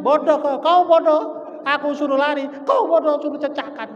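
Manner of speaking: loud, high-pitched angry bickering; acted quarrel. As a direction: hurl the blame back and forth at full volume. (bertengkar saling menyalahkan dengan suara keras)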